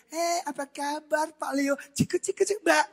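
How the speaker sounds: high, gushing put-on voice; hammed-up sugary hello, pitched high; mock friendly greeting. Preacher mimics friendliness he cannot do, high gushing greeting. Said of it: "kurang ramah orangnya".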